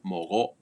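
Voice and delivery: mogo cut off in the throat; "mogo" ending abruptly; glottal stop. Shown as a demonstration. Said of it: "It cuts off".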